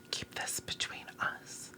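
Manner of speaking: conspiratorial whisper to the audience; secret whisper. Pretend. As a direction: lean in and whisper it. Shares a secret with viewers.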